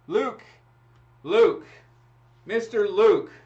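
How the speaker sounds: loud, high call for Luke. Real, not acted. Host calling out to winner Luke, loud and high. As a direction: call out to get his attention.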